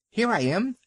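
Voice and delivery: high, sharp yappy little dog; yapping little dog. A narrator's reading. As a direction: yap it out like a small terrier. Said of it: "yapped Vixen".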